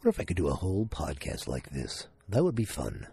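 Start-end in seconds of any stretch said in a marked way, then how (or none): 0.0-2.1 low, smooth announcer voice. Acted. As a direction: play the radio announcer. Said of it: "is it my announcer voice".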